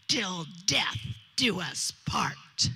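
slow, breathy whispered vow. Retold or imitated. Bride whispering her vow to the groom, slow and hushed. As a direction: breathe the words out slowly, full of meaning.

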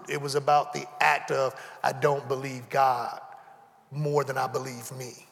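ordinary talk, nothing marked

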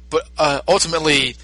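ordinary talk, nothing marked

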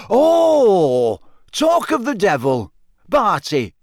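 loud, high "oh", then a hearty hail; surprised greeting. A narrator's reading. Official spotting his colleague arriving, delighted surprise. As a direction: call out in jovial surprise. Bagman hails the arriving Crouch.

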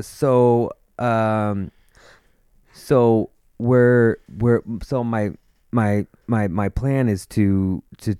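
halting restarts with long pauses and repeated words; imitated stuttering lawyer. Lawyer explaining his plan to a client, imitated stutter. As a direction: get stuck on small words, mimicking a stutter. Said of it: "he would constantly stutter. He would be like".

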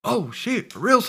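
put-on high, loud, indignant drunk buddy; buddy yelling as he leaves, high and loud; rowdy drunk friend.

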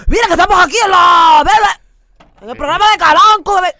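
mock on-air voice. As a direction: ham up his showy on-air persona. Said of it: "una persona normal que habla así". (imitación exagerada de su voz de locutor, gritando más agudo)

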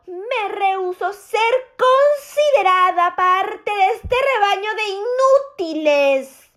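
normal talking voice

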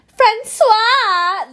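high, loud, drawn-out call; dog-park yell. Acted. Testing the name at a dog park, yelled. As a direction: holler it across the park for a dog. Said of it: "park and you were like".

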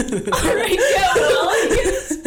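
squeaky high teasing imitation; mocking high voice. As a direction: parrot her words back, high and laughing. Mimicking friend's reply, laughing.

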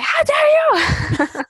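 high, loud, drawn-out mock yell; mock outburst. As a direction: cry out in hammed-up outrage, half laughing. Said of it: "you have bursts of, like".

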